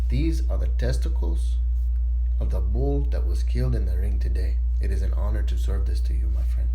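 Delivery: put-on low, proud, lightly Spanish-accented waiter; a hint of a formal Spanish host. Spanish waiter speaks to Johnny.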